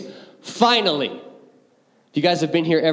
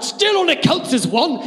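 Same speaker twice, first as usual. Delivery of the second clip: higher, hammed-up battle shout; Gimli shout; 8 semitones higher than usual. A dwarf calling out to Legolas mid-battle, a higher mock shout. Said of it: "Gimli's like".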